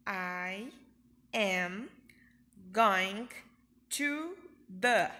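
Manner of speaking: I am going to the said word by word, going and the punched loud; teacher drilling word by word; slow drill. Shown as a demonstration. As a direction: chop it into single words. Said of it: "eu respondo".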